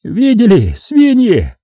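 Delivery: high, squealing angry cry; high-pitched gloating yell; shrill shout. A narrator's reading. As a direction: shriek the insult at them, high-pitched. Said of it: "только слышен был визгливый голос".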